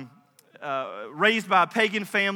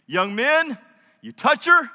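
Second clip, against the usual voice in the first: pitched-up, questioning warning; mock challenge; 3 semitones higher, 5 dB louder, slower than usual. Boaz warning young men off Ruth, mock challenge.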